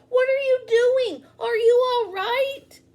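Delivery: loud, high anxious shouting; worried yelling. Acted. Boy shouting worriedly into the pool, loud and high. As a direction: call out in fear for him.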